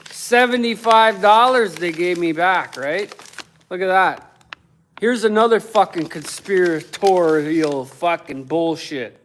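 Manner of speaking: normal talking voice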